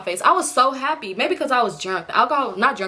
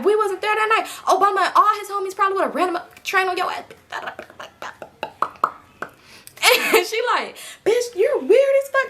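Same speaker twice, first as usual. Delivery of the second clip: high, pitched-up mocking rant; going off; 8 semitones higher than usual. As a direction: shriek insults at her. Friend going off on her.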